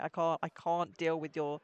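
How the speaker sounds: ordinary talk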